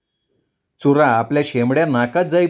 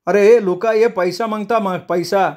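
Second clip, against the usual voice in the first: raised, urgent holler; calling out; 7 semitones higher than usual. A narrator's reading. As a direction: turn and yell for help. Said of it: "वळून ओरडला".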